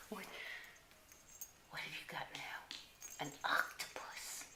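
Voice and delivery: soft breathy whisper so as not to startle them. Real, not acted. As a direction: hush your voice, lean in close to the pups. Whispering to the puppies.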